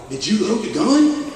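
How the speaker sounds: teasing son asks his dad; son's question. Retold or imitated. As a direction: ask it like a skeptical kid.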